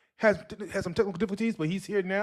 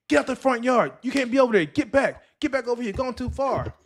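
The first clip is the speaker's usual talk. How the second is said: mildly raised, higher-pitched mom calling out; mother yelling; 7 semitones higher than usual. Retold or imitated. Mother telling running kids off the front yard, mock yelling. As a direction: imitate a mother scolding kids from afar, half-strength. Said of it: "their mother yelling".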